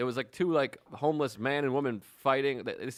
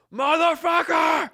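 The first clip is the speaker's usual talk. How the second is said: full-throated high scream, drawn out; retold scream; 13 semitones higher, 8 dB louder, much slower than usual. Imitating a passenger cutting off the delay announcement, screaming.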